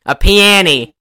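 loud mock hillbilly answer; porch whittlers. Whittlers saying what they are making, mock yokel. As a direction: call it out in a light yokel voice. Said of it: "They go".

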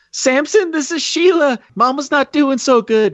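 high-pitched mock female caller; sister on the phone. Samson's sister reporting on mama, high put-on voice. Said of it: "Your sister's on line one".

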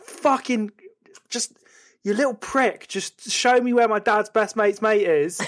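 worked-up, halting irritation; fuming. Acted. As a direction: act out losing patience. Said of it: "And then you're fuming".

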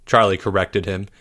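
plain reading voice

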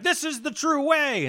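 high-pitched, hammed-up proclamation; mock zealous preacher. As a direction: proclaim it like a pushy missionary. Acting preachers arriving to convert.

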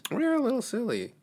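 silly high voice. Pretend. Playful silly voice.

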